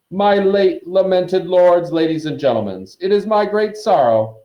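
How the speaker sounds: high, loud, solemn oratory; pompous ghost orator; ceremonial speech. A narrator's reading. Nick opens his formal speech.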